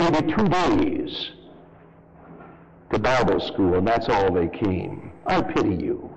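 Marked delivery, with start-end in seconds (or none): none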